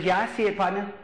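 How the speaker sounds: lightly voiced Cajun uncle; Cajun accent. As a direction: imitate a Cajun uncle's easy reply. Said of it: "he's got that accent".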